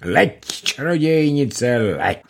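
ringing call to the witch, slowed; shouting. A narrator's reading. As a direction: call out slowly, chanting. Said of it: "křiče". (volání, pomalé a hlasité)

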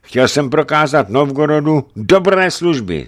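high, loud, impassioned justification; boyar's heated self-defence; fervent exclamation. A narrator's reading. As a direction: cry out your good intentions. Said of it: "zvolal bojar nároživně".

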